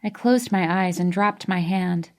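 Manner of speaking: ordinary delivery, nothing marked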